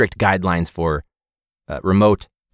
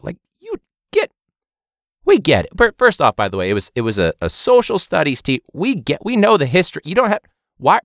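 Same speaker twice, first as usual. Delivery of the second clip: exasperated rant; 7 semitones higher than usual. Real, not acted.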